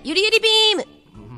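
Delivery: high sugary idol voice; cutesy idol catchphrase. Acted. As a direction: chirp it like a cute idol. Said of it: "ニコルのキャラをパクってやがる".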